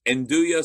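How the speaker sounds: Nduja said slowly as "en-DOO-ya". Shown as a demonstration. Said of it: "not andouille, nduja".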